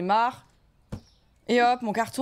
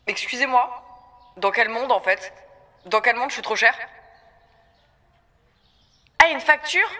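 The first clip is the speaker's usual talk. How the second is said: indignant; 5 dB louder than usual. Acted. Outraged at bills.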